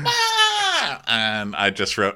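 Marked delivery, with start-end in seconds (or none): 0.1-1.0 high-pitched wailed cry; mock scream. Captive reacting to the little crab, mock scream. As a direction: wail in hammed-up panic. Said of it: "And he's like".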